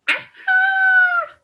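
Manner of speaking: high, loud witchy cry; bad fairy's cry. A narrator's reading. Bad fairy finding Rosamond in the glade, witchy shout. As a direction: pounce with a triumphant screech. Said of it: "she cried".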